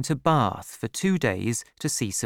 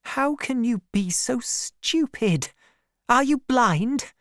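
very high, drawn-out distressed outburst; crying out; 10 semitones higher, slower than usual. A narrator's reading. Woman confessing her love to her maid, distraught. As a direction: let it burst out, high and slow. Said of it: "cried Bathsheba".

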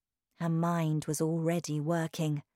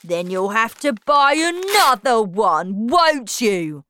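louder sneering voice; mocking father; 4 semitones higher, 7 dB louder than usual. A narrator's reading. Father taunting Matilda while ripping pages. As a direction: taunt her coldly.

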